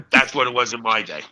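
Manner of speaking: ordinary talk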